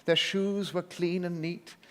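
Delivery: plain reading voice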